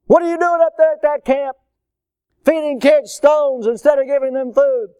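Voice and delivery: high, loud outraged demand; indignant parent. Acted. Parent phones camp about stones for food, indignant. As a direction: chew out the camp over the phone.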